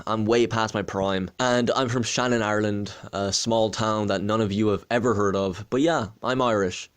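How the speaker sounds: usual speaking voice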